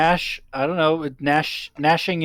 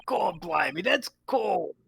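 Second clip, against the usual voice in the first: high Cockney protest; Cockney crook; 6 semitones higher, slower than usual. Acted. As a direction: complain as a pitched-up Cockney villain. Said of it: "they are Cockney".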